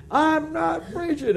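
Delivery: high, slow indignant trucker yell; hammed-up high protest; mock scream. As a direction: play the angry trucker denying it. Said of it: "He screamed".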